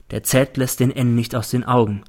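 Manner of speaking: ordinary delivery, nothing marked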